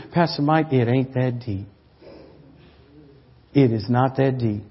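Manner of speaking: very soft and low, barely voiced; soft whisper. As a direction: murmur it close, unhurried. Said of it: "He whispers in, in my ear".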